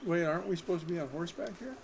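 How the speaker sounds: ordinary talk, nothing marked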